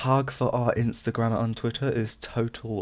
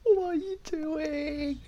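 high, slow what are you doing; stretched incredulous squeak; squeaky disbelief; 4 dB quieter, much slower than usual. Real, not acted. High drawn-out reaction.

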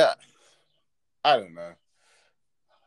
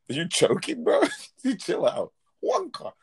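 much higher, hammed-up fake British accent, shooting up at the end; 12 semitones higher than usual. Mocking Americans who fake British slang, hammed-up British accent. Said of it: "try and say it in a British accent".

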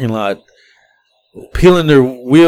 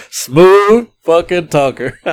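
laughing; 4 semitones higher, much slower than usual. Real, not acted. Laughing at the passenger's line.

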